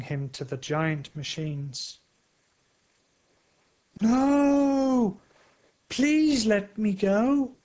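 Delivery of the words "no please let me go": high, loud, slow pleading scream; desperate shout. A narrator's reading. Thunder on the crane's hook screams in terror. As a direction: beg to be let go, terrified. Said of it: "shouts Thunder".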